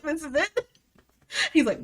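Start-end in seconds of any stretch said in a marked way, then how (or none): 0.0-0.7 mock surprised girl. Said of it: "She's like".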